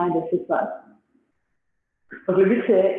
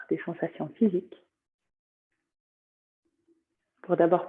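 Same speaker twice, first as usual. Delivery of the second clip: calm yoga nidra guidance, long pauses between phrases; guided meditation. Acted. Teacher guiding students through body sensations, meditative voice.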